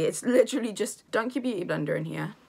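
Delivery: ordinary talk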